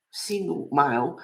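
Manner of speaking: ordinary talk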